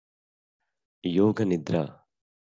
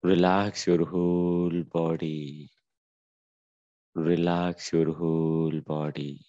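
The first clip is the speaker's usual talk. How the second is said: slow meditation cue; much slower than usual. Acted. Yoga teacher relaxing the class, very slow soothing cue. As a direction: say it slowly and let it settle.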